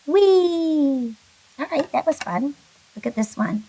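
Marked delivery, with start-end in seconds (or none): 0.0-1.1 high, louder wee. Shown as a demonstration. Teacher demonstrating the wee sound, high and bright. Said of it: "W, and then E".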